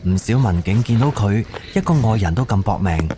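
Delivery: ordinary talk, nothing marked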